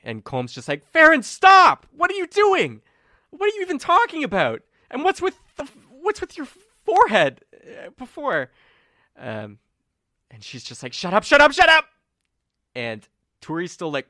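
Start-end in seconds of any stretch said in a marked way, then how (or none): 0.9-8.5 very high, loud frantic yell. Acted. Colm yelling at Farron to stop, high and frantic. 10.9-11.9 high, loud furious scream. Acted. Farron screaming at Colm to shut up, high and furious.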